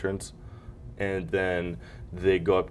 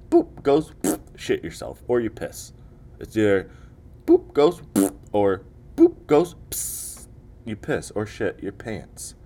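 mock ghost panic; 3 semitones higher, 4 dB louder than usual. Acting out seeing a ghost.

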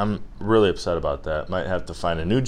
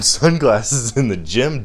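ordinary talk

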